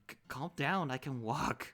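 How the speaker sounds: flustered protest. Acted. Irritated at being dragged.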